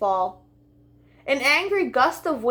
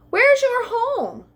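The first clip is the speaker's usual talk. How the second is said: high, slow little lamb asking; 13 semitones higher, 4 dB louder, slower than usual. Acted. Lamb asking the butterfly where it lives, high and slow. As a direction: sing it up high like a curious lamb.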